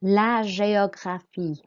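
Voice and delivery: GÉOGRAPHIE slowly, "zhay-o-gra-FEE"; slow model of GÉOGRAPHIE with a "zh" opening; soft French G. Shown as a demonstration. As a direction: draw out GÉOGRAPHIE for learners, é as "ay". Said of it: "the G makes a Z sound".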